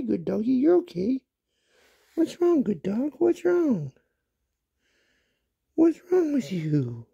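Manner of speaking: high, soothing voice for a dog; calm the puppy down, high and sweet; pet talk. Real, not acted. Owner soothes her puppy with a high pet voice.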